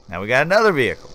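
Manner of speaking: ordinary delivery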